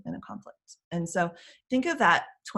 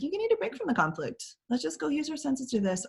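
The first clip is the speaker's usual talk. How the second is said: coaxing adult; 9 semitones higher than usual. Retold or imitated. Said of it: "I was like".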